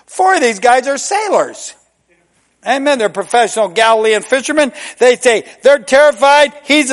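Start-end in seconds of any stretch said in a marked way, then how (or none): none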